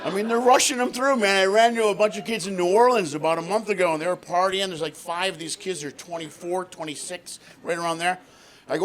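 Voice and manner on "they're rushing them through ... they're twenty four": ordinary talk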